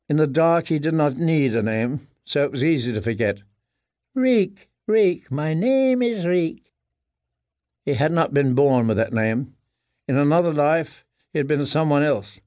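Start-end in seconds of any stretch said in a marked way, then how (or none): 4.1-6.7 high, slow, pleading repetition; cowed whimper. A narrator's reading. Broken prisoner clinging to his given name, cowed whimper. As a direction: wail it out high, drawing out the words.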